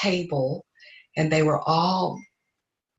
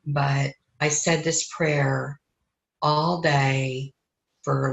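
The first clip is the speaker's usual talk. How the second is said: ordinary delivery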